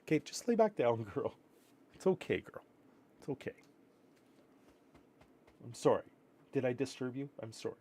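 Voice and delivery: soft, hushed reassurance fading almost to nothing; soothing the dog. Real, not acted. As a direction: gently settle a dozing dog back down.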